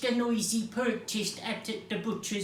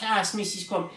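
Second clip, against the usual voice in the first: ordinary delivery, nothing marked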